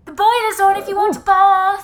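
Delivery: high, loud call through to another room. Acted. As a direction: shout it so it carries to someone out of sight. Calling to someone bathing elsewhere.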